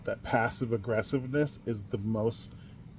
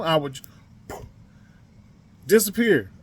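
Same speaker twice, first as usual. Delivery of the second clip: ordinary delivery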